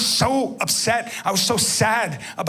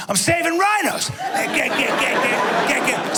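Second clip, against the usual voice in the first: high, loud shouted rant; frenzied rhino-saving shout; frantic shout; 6 semitones higher, 3 dB louder than usual. Retold or imitated. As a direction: yell it like a man gone wild. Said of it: "I'm like".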